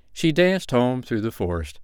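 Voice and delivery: plain reading voice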